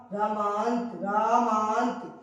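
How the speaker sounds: रामांत said low and slow, the final त dropped soft; slow soft ending. Shown as a demonstration. Teacher slowly demonstrating रामांत to students. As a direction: draw out रामांत, letting the end fade quietly. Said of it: "इसको यहाँ तक कस के बोलेंगे".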